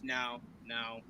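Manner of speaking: low, very soft string of no's; quiet refusal. Acted. Host answering hopeful fans at home, quiet low refusal. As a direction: shut it down quietly, low, with pauses between. Shooting down hopes of those at home.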